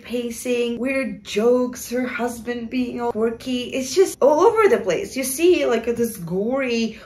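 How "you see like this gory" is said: ordinary talk, nothing marked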